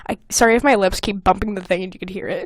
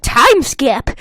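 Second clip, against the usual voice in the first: announcing; 2 semitones higher, 4 dB louder than usual. Shown as a demonstration. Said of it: "That's how I wrote it".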